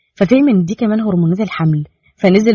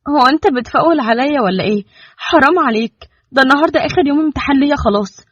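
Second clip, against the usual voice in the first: hiding tiredness; 6 semitones higher than usual. A narrator's reading. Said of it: "بتداري تعبها".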